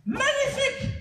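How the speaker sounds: très aigu, façon ténor qui parodie; ténor parodique. Acted. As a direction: monte en voix de ténor exagérée. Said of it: "une voix de ténor". (voix de ténor pour rire)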